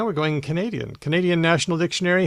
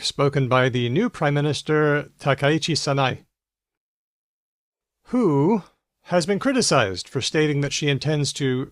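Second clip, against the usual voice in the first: ordinary talk, nothing marked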